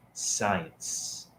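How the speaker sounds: hushed, low, nearly voiceless "science"; cringing. Real, not acted. Host repeating a word that makes them cringe, hushed. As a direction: breathe the word out with distaste. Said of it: "That word makes me cringe".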